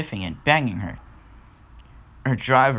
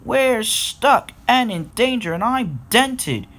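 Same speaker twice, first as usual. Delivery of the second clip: high, slow cry of distress; 11 semitones higher, 4 dB louder, slower than usual. A narrator's reading. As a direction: wail it out word by word, pitched up. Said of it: "cried Wisdom".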